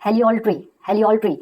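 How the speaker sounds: heliolatry, said slowly twice; teacher modelling heliolatry; pronunciation demo. Shown as a demonstration. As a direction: sound out heliolatry for the class. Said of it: "तो इसको क्या पढ़ेंगे?".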